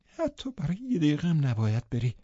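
high, soft desperate voice; clinging, high-pitched plea; desperate refusal. A narrator's reading. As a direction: refuse in a thin, pleading voice. Catherine refuses to let go.

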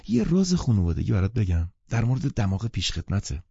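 ordinary delivery, nothing marked

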